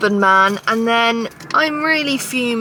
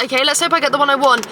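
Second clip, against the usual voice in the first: usual speaking voice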